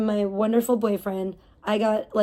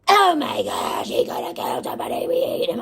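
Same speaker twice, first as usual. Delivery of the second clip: mocking commenters. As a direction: imitate outraged commenters, hammed-up. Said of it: "people are like".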